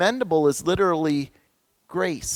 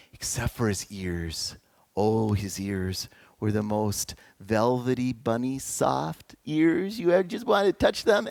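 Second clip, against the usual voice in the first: tender savouring; 5 semitones lower than usual. Real, not acted. Preacher fondly describing his husky's ears, tender and hushed.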